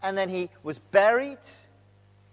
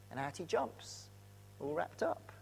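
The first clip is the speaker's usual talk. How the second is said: normal talking voice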